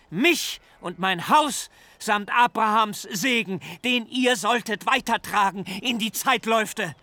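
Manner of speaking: put-on furious old father. A narrator's reading. Jakob berates his sons for the bloodshed, high and anguished. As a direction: cry out at them in despair.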